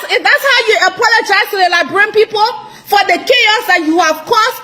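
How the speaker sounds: ordinary delivery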